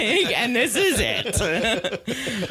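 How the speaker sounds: slow, high, laughing sarcasm. Real, not acted. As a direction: laugh it out slowly.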